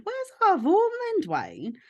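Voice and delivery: mum pressing with a sharp high question; mum asking again. Retold or imitated. Sister mimics mum pressing Dwayne about Siobhan, high and insistent.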